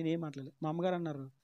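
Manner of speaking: usual speaking voice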